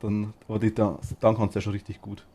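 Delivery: usual speaking voice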